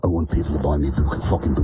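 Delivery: slipped into acting. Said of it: "I slipped into a brand of acting".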